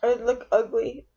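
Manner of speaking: sniffly tearful talk; snotty crying. Real, not acted. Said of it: "my face all puffy".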